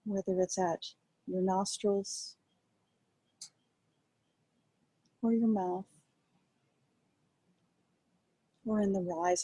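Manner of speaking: calm, quiet guiding voice with long pauses; guided meditation. Acted. Teacher guiding a group to rest attention on breath, calm meditation voice. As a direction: ease listeners gently into noticing the breath, unhurried.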